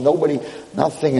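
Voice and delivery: normal talking voice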